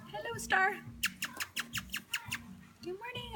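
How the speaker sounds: high sing-song pet voice. Real, not acted. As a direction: coo at the puppy. Talking to puppies.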